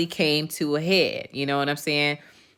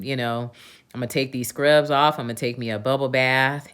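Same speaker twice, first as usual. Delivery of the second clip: usual speaking voice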